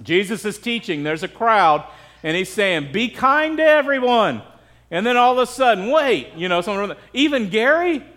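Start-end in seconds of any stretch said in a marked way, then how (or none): 2.9-4.4 lightly acted preacher, pitched up; a hint of open-air teaching; teacher addressing crowd. Jesus voiced preaching to a crowd. 5.9-6.3 higher, mock interruption; lightly acted objector; interrupting heckler. Interrupter voiced in cartoon retelling. 7.1-8.0 higher, mock disbelief; lightly acted doubtful objector; incredulous question. Crowd member questions Jesus in cartoon.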